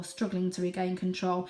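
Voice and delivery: ordinary delivery, nothing marked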